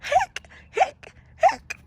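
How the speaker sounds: high, loud screaming hics; screamed hiccups. A narrator's reading. Skeleton scared by the mirror, screaming hics. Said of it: "Skeleton screamed".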